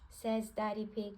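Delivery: ordinary delivery, nothing marked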